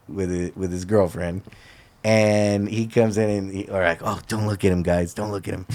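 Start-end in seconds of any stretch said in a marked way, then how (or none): 4.0-5.6 low, hushed aside to friends; furtive warning. Retold or imitated. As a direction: retell it under your breath, trailing softer. Retelling a furtive warning among friends.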